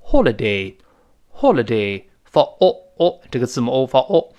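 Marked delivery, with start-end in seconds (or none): 0.0-2.0 British "holiday" with a rounded o in the first syllable; British rounded-o "holiday", twice; British pronunciation. Shown as a demonstration. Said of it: "英式发音当中".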